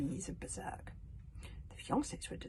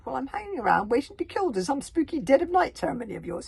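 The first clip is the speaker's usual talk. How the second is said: coarse reply; 8 semitones higher, 7 dB louder than usual. Acted. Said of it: "he coarsely replied".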